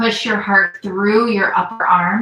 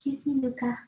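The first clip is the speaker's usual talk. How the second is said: higher, hushed little reproach; soft aside to pet; 6 semitones higher, 10 dB quieter, faster than usual. Real, not acted. Yoga teacher to her cat mid-class, soft high aside. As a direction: softly chide a pet in a higher voice.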